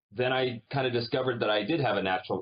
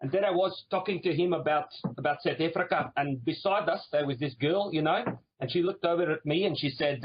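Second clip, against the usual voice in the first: put-on higher-pitched South African accent; 7 semitones higher than usual. Actor retelling a lunch about Cape Town, South African accent. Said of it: "I started speaking with a South African".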